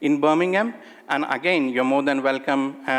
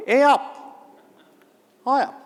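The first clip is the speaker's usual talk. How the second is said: Yorkshire greeting; 10 semitones higher than usual. Retold or imitated. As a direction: call it out cheerily like a Yorkshire local. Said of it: "and the greeting is".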